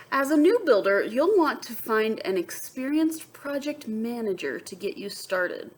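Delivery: bright read-aloud to the class; lively storybook voice; story narration. A narrator's reading. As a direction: read it like a picture book to kids. Reads picture book aloud.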